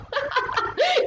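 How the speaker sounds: high burst of amused laughter; real, high-pitched chuckle; light laugh. Real, not acted. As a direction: laugh brightly at your own remark. Laughs at modern time pressure.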